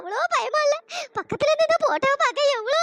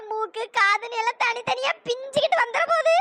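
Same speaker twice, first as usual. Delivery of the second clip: ordinary talk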